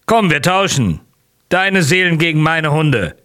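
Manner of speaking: pushy braggart. A narrator's reading. Nozdrev pushes a wild trade.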